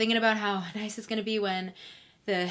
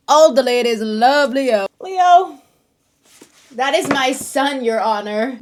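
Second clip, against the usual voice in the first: gushing; 9 dB louder than usual. Real, not acted. Gushing over Leo.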